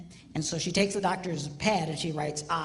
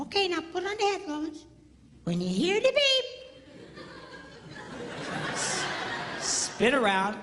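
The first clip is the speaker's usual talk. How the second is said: audiologist voice; 8 semitones higher than usual. Retold or imitated. Audiologist over the intercom.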